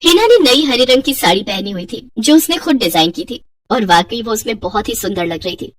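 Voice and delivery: ordinary delivery, nothing marked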